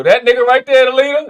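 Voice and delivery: loud, amused pointing at the leader; spotting the leader in the video, excited and loud. Real, not acted.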